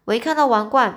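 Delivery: ordinary delivery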